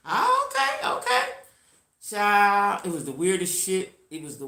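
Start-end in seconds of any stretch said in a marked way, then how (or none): 0.1-1.2 very high squeaky okay; mock squeaky okay. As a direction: squeak out the okays mockingly. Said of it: "He said".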